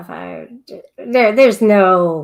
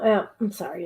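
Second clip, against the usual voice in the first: ordinary talk, nothing marked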